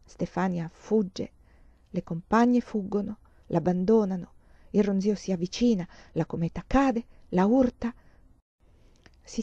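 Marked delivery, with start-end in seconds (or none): none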